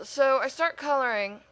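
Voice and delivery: ordinary talk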